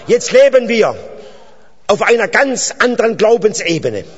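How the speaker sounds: loud, high-pitched proclamation to the congregation; fervent preaching. Real, not acted. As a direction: proclaim it with rising fervour, leaning on key words. Preaches at full force.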